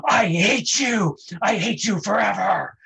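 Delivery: shrill-high, loud, slow soap outburst; hammed-up TV-movie screaming; melodramatic yell. Coach mocks Candy's soap-opera line, shouting it. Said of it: "Candy's like".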